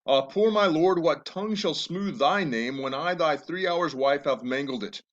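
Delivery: Juliet's lines read with feeling; lightly acted Shakespeare reading; dramatic reading. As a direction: read the verse as a grieving young bride. Reads Juliet's lines aloud.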